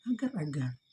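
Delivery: ordinary delivery, nothing marked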